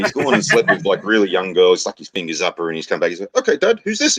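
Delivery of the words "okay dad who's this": very high-pitched son voice; acted cocky high-pitched question; challenging son. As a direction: quiz your dad in a high kid's voice. Son repeats challenge in joke.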